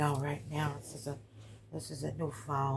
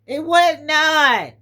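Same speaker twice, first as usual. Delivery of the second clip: emphatic declaration; 9 semitones higher, 15 dB louder, slower than usual. Real, not acted. Emphatic point to the audience.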